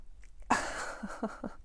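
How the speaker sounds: soft, breathy laugh; rueful laugh. Real, not acted. Reader to struggling parents, laughing so as not to cry. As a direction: laugh quietly at how hard it is. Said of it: "I'm laughing because it's almost, like, so hard".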